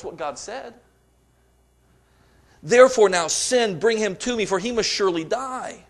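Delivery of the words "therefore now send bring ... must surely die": higher and louder, scolding fury; angry outburst. Acted. Saul unloading on his son Jonathan, higher and louder. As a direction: hurl it at the son like a raging king. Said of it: "Saul's anger burned against Jonathan".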